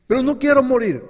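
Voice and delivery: loud, high cry of pleading; anguished plea. Acted. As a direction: beg for your life. Sinner pleading with God.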